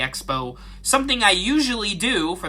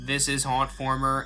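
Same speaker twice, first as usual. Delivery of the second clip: monotone voice; 3 semitones lower, 6 dB quieter than usual. Shown as a demonstration. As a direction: read it deadpan like a dull announcer. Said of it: "stop speaking in a monotone voice".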